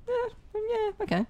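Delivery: humouring someone you don't believe; mock-unimpressed shrug; unimpressed okay. Unimpressed by Helen of Troy.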